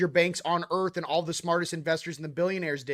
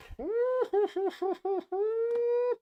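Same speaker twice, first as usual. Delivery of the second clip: high laugh. Real, not acted. Laughs at own joke.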